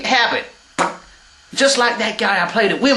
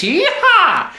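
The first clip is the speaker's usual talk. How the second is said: cowboy whoop; 7 semitones higher than usual. Acted. Cowboy whoop in Texan character.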